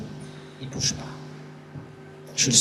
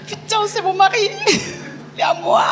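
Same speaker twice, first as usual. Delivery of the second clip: high, loud squeal with laughter; gleeful squeal; 7 dB louder than usual. Retold or imitated. Woman boasting that the handsome man is her husband, gleeful squeal. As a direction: crow it loudly, bursting into a laugh.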